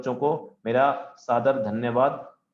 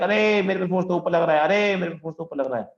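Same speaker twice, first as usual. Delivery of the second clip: higher mock yell of the particle; mock shouting; 6 semitones higher than usual. Teacher voices the particle shouting about the force. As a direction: call out like a panicked particle. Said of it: "चिल्ला चिल्ला के".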